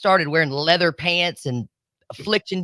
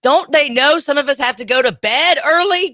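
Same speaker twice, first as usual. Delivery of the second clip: loud, high-pitched indignation; exasperated complaint; 8 semitones higher, 4 dB louder than usual. Real, not acted. Host griping about having to go to bed early, exasperated. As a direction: protest it loudly, pitch jumping up.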